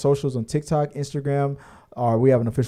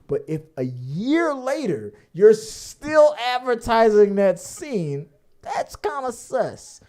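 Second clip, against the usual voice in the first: drawn-out teasing; 8 semitones higher, slower than usual. Real, not acted. Teasing the director.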